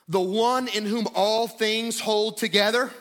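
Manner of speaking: fervent pulpit declaration; impassioned preaching. Real, not acted. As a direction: build in pitch as you declare his majesty. Preaches Christ's exalted status.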